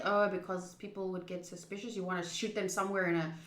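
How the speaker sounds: usual speaking voice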